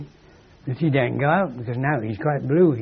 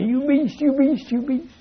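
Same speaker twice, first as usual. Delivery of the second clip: high, wailing mock-tearful complaint; mock crying; 8 semitones higher, 4 dB louder than usual. Wife crying at her husband to get her own way, mock crying. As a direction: imitate a tearful wife, pitched way up. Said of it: "so they cry and say".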